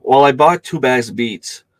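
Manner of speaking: ordinary talk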